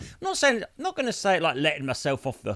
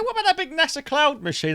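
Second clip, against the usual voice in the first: higher-pitched, tall-tale delivery; deliberately sounding bogus; 8 semitones higher than usual. Shown as a demonstration. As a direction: pitch it up like obvious nonsense. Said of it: "so it sounds like bollocks".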